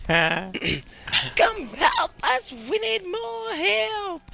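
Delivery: very high, slow kobold call. Acted. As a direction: squeak out a slow fake plea. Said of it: "do that kobold voice again".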